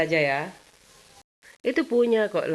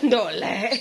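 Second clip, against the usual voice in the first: laughing; 6 semitones higher than usual. Real, not acted. Laughing repeat of word.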